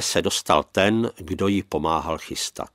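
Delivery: ordinary delivery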